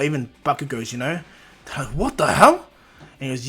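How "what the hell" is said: loud, higher cry of disbelief; stunned outburst. Retold or imitated. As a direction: blurt it out in shock. Classmates react in shock.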